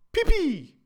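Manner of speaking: put-on very high loud kid holler; shrieking little-girl holler; hollering child. A narrator's reading. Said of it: "Loretta hollered".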